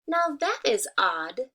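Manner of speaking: slow, puzzled hen voice. Acted. Hen noticing a strange tree, slow and puzzled. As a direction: wonder aloud like a curious hen. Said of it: "said the hen".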